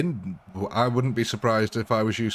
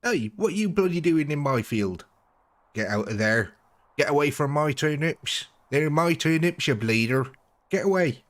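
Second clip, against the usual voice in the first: West Country farmer; 5 semitones higher than usual. Shown as a demonstration. Farmer yelling at intruders about his turnips, higher, mock angry West Country. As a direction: shout off trespassers in broad West Country. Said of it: "they all talk a bit more".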